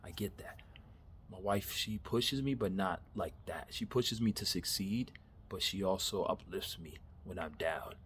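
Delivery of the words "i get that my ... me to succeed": ordinary talk, nothing marked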